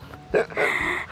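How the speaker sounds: breathy high laugh; high unvoiced giggle. Real, not acted. Laughing at shortcut idea.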